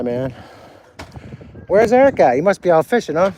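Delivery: ordinary talk, nothing marked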